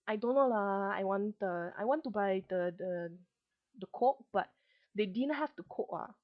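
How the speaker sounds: sliding into Malaysian English, chatty; Malaysian English accent. Shown as a demonstration. Vlogger demos Malaysian English to viewers, Manglish accent. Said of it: "kinda sounds like this. I'll try to do it".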